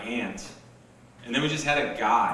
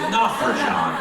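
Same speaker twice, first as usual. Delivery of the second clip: laughing; 9 semitones higher, 4 dB louder than usual. Retold or imitated. Friend reads notes, laughing.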